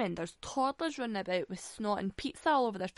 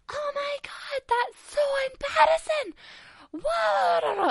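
mock freak-out in a piercing falsetto; hammed-up high screech, mimicking a panicked man; high-pitched squeal; 6 dB louder, slower than usual. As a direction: squeal it very high, imitating his screaming, building to a shout. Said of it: "High-pitched, high squeal".

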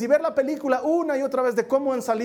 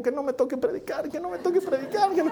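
mock reluctant plea; 7 semitones higher than usual. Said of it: "pasé al otro equipo".

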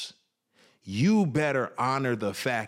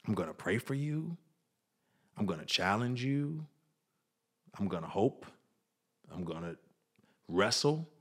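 softer, quiet, steady promise; a quiet vow, fading almost to nothing; tender vow; 5 dB quieter than usual. Retold or imitated. Wife promising her husband she won't leave, softly tender. Wife vows to stand by him.

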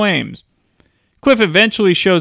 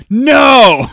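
loud, higher-pitched yelled NO; imitated yell; 7 semitones higher, 7 dB louder than usual. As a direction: yell it like an irked ticket guy. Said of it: "the guy yells at him".

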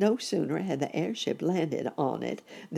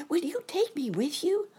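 put-on high, pleading child voice; hopeful, anxious boy rabbit. A narrator's reading. Boy rabbit begging his uncle for a ride, high and hopeful. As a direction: ask hopefully in a high little voice. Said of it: "looking sort of hopeful-like and anxious".

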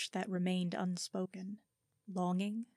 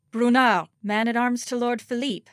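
bold lie; 4 semitones higher, 11 dB louder than usual. Acted. Lying to the camp watch.

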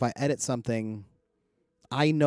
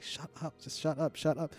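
very soft, slightly raised inner voice; internal muttering; 4 semitones higher, 9 dB quieter than usual. Retold or imitated. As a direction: seethe under your breath, acting out silent thoughts. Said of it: "internally, like".

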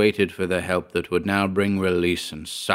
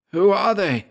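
high, urgent character question; anxious question, much higher; quick, urgent Georg; 12 semitones higher than usual. A narrator's reading. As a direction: demand it, pitched sharply up. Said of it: "asked Georg quickly".

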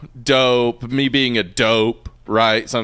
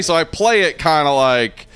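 ordinary talk

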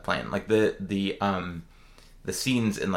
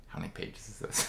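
much softer, near-whispered aside; hushed inner thought; 3 semitones lower, 12 dB quieter than usual. Retold or imitated. Reader checking how long the book is, softly to herself. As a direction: mutter it to yourself mid-book.

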